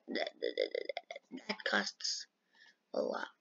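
low whisper. Real, not acted. Gamer admitting the rank costs money, low fading whisper. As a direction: drop to a whisper as if it hurts to admit. Complaining about rank cost.

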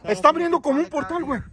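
high-pitched urgent report; alarmed. Real, not acted. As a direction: point out danger in alarm. Alarm at fire and a portal. (alarmado, con voz aguda)

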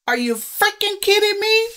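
high-pitched indignant question; outraged disbelief. Real, not acted. Outraged at the plot twist.

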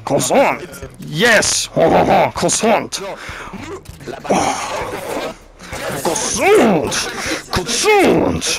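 hammed-up fake French accent; mock French. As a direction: play the silly Frenchman. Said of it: "I can speak his language".

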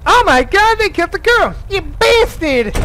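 hammed-up shocked yell; lightly voiced horrified kid; cartoon catchphrase cry. Cartoon catchphrase on character death.

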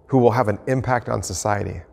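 normal talking voice

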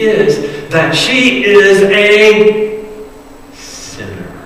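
rising scornful aside building loud; a proud Pharisee sneering to himself; a preacher voicing the scornful host under his breath; smug Pharisee muttering. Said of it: "He said to himself".

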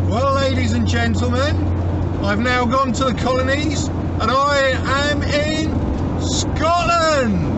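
loud, high, showman's welcome to the audience; grand announcement. Real, not acted. Vlogger announcing to viewers he's reached Scotland, loud and high. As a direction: proclaim it like a ringmaster.

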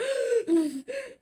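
sharp high gasp. Retold or imitated. Retelling crying over the sound, a high gasp. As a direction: gasp in shock. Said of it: "I was like".

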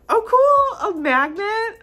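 loud, high-pitched squeal; delighted surprise. Real, not acted. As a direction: exclaim over a surprise gift. Delighted discovery of a gift.

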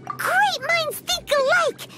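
high, drawn-out gleeful cheer; slow it down and sing out your delight; excited agreement. Acted. Companion delighted that Xiao agrees, high excited exclamation.

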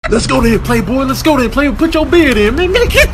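ordinary talk

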